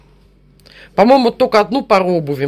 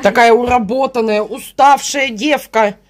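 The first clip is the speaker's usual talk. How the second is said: mock pity; 6 semitones higher, 4 dB louder, slower than usual. Mock pity for tired daughter.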